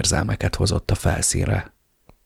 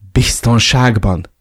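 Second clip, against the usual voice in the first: Biztonságban? shrieked higher, louder and slow; shrieked disbelief; 4 semitones higher, 6 dB louder, slower than usual. Acted. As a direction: fling the word Biztonságban back in shock. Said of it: "sikított fel". (felsikoltva, hitetlenkedve: Biztonságban?)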